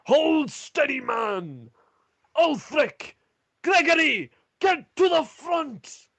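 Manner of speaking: high, loud yell over an army; urgent commander's shout; shouted battle commands. Acted. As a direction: call your men forward at full voice. Commander calls orders in battle.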